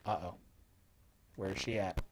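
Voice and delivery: hushed nervous dread; tense, quiet and wary; being chased. Real, not acted. Nun approaching in horror game.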